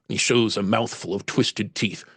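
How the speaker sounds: ordinary talk, nothing marked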